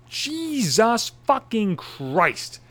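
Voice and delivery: high, drawn-out exasperated curse; exasperated outburst. Real, not acted. Fed up with kids reading political spots, exasperated. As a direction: stretch each word out in disbelief.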